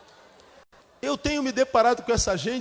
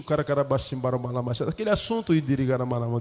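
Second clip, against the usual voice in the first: mumbled mock gibberish, lower; lightly imitating a churchwoman's tongues babble; mock speaking in tongues; 8 semitones lower, faster than usual. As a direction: garble the words like a half-heard tongues prayer. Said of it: "Ou a senhora fala língua estranha".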